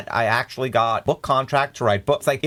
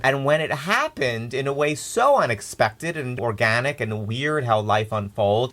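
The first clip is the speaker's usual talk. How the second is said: ordinary talk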